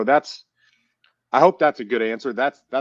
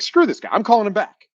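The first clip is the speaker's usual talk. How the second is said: higher and louder, fired-up and indignant; mock fury, loud and pitched up; mock outrage; 11 semitones higher, 7 dB louder than usual. Caller hung up on him, so he fires up in mock outrage. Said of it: "That stuff pisses me off".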